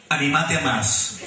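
low, slow slogan; deadpan poster reading. Retold or imitated. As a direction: deliver the slogan low and drawn-out.

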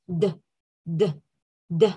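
isolated "d" with a short voiced release; teacher's "d" sound demo. Shown as a demonstration. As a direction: say a bare, voiced "d". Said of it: "Second sound".

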